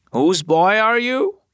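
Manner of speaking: high, teasing grandmother's question; playful grandmother. A narrator's reading. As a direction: coo it like a gran playing with her grandson. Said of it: "said old Ma Parker".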